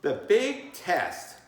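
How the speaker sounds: ominous test announcement; dramatic title. Acted.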